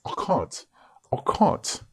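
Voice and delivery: high-pitched put-on British accent. Tutor practising an accent he admits he can't do, British accent. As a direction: try it in clumsy, high British English. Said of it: "I'm not mocking the British accent".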